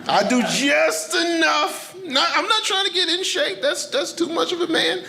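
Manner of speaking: high excited rant. Real, not acted. Jokes about getaway shape.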